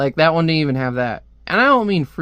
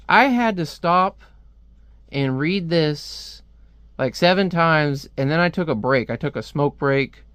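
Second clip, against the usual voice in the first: usual speaking voice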